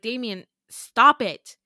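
loud, drawn-out retold protest; firm protest. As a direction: act out telling someone off, loudly and slowly. Grabbed, telling him to stop.

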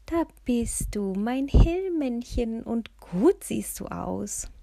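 put-on lovingly greeting her old car like a pet; fond, drawn-out coo to a beloved car; loving old lady. A narrator's reading. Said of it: "legte liebevoll die Hände auf das Auto".

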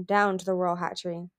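plain reading voice

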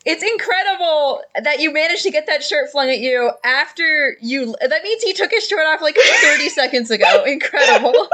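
talking through real laughter; can barely get it out for laughing. Real, not acted. Game master cracking up at the player's shirt, laughing.

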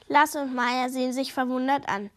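ordinary delivery, nothing marked

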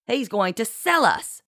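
high, accusing bitterness; bitter. A narrator's reading. Kit exposes Francois's plan, bitter. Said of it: "Kit supplied bitterly".

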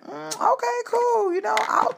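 mock-breezy okay-cool reply; sarcastic high mock-cheerful. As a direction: brush it off with fake brightness. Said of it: "I was like".

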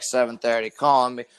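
normal talking voice

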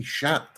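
SHAT with a soft French "sh"; SHAT starting like "shoe", Frenchified; French pronunciation. Shown as a demonstration. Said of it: "him being French".

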